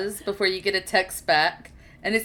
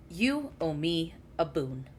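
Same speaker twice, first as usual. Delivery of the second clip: ominous Gabriel; 4 semitones lower, 8 dB quieter than usual. Acted. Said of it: "Eric and goes".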